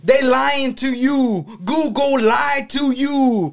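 slow, high, ranting [name]; a hint of a preaching [name]. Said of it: "I feel like [name] right now".

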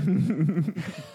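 loud, high laugh. Real, not acted. Game master laughs at player's refusal to teleport, laughing. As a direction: burst out laughing loudly.

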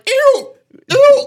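high shrill goat bleat. Shown as a demonstration. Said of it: "I don't think, like".

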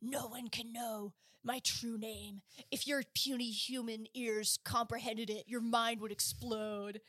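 mock dragon voice. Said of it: "when dragons are like".

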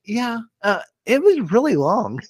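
high kid voice, lightly mocking daughter; imitating a child. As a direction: play a young girl bluntly bored. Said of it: "she's like".

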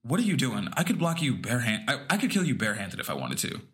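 low, steady threat, lightly voiced mother; mom's exact cadence. Son imitating his screaming mother's threat, low menacing. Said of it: "I'll give you the exact cadence".

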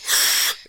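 quick inhaler-style gasp; sharp gasp. Shown as a demonstration. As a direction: suck air in sharply. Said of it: "'Cause you go".

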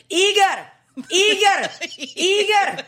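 high, loud repeated calling; calling out loudly. Retold or imitated. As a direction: shout the name from another floor. Mom yelling for dad.

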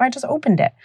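put-on high-pitched innocent kid reply; little boy. Boy tells mother how he got out, imitated high child voice. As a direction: imitate a child's simple explanation. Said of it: "So he's like".

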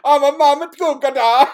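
high, loud, hammed-up Italian accent; mock Italian accent. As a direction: ham up a cartoon Italian accent like Mario. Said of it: "we're gonna go full fucking Mario".

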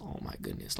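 hushed wonder at a glass mansion; awed whisper. Real, not acted. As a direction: breathe it out, amazed. Awed by a rich house.

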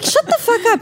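high, exasperated scoff; mock exasperation. Mocking actors' junket answers, exasperated. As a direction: roll your eyes and scoff high. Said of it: "mocking press junket actors".

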